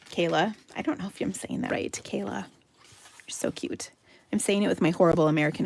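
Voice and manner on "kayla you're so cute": soft slow whisper. Real, not acted. Host whispering a compliment to Kayla, slow and soft. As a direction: breathe it out fondly, slowly. Host fondly addressing her friend.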